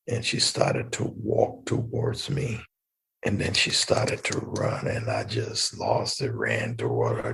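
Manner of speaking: hushed, near-whispered, voice dropping low; barely holding it together, quiet and low; reliving the moment he saw his daughter again; choked up. Real, not acted. Reunion with daughter after years.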